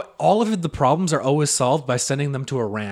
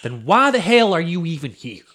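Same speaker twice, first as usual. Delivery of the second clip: emphatic phrases broken by long pauses; mock TV counselor cadence; Doctor Phil; 3 semitones higher, 3 dB louder than usual. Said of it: "the cadence of Doctor Phil".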